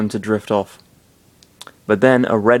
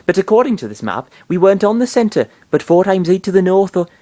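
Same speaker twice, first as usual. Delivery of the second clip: put-on Yorkshire accent; 10 semitones higher, 6 dB louder than usual. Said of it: "it's, uh, Yorkshire".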